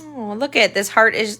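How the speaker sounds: ordinary delivery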